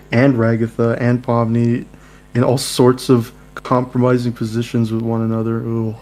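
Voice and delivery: usual speaking voice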